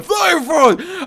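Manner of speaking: hammed-up Gandalf, high and loud; mock a wizard yelling mid-fall; Gandalf quote. Gamer hams up Gandalf falling, high slow yell. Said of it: "and he's just going".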